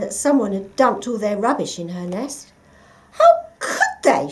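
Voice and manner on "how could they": very high, loud, slow screech. A narrator's reading. Partridge finds rubbish in her nest, screeching. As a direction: shriek in outrage. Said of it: "she screeched".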